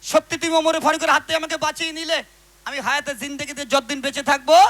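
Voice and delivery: loud, declaimed cry to Allah; acted desperate plea. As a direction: call out to God as the repentant drunkard. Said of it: "ধুলোয় পড়ে গড়াগড়ি খাচ্ছে". (আল্লাহর কাছে জোরে আকুতি)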